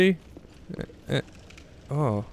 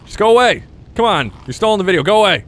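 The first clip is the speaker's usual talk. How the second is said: frustrated yelling; 5 semitones higher, 4 dB louder than usual. Real, not acted.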